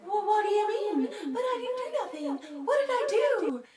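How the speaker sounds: high, whiny, tearful protest; mock innocent. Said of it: "they just act so innocent".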